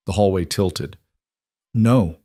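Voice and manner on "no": ordinary delivery, nothing marked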